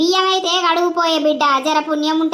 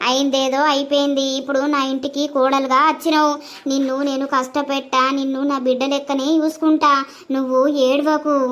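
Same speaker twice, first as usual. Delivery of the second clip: put-on lower, consoling old grandmother; low, gentle grandma comforting the bride; grandmother's voice; 3 semitones lower than usual. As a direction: soothe the crying girl as her granny.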